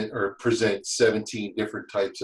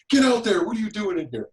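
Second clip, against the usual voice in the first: higher, louder barked orders; foreman screaming; 11 semitones higher, 4 dB louder than usual. Retold or imitated. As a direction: yell him out of the trailer. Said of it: "my foreman screamed at him".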